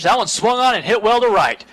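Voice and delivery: live baseball call gathering steam; excited play-by-play. Real, not acted. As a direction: build excitement as the ball carries. Live call of a hit ball.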